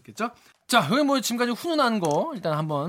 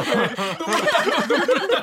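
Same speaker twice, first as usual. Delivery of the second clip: mock comforting; 7 semitones higher, 4 dB louder, slower than usual. Teasing a colleague as if crying.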